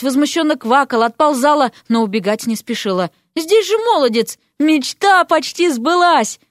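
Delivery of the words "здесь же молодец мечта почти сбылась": high, excited exclamation; urgent urging. A narrator's reading. Vasya urges Zelenushka to take the arrow, excited. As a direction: push a reluctant friend toward her dream.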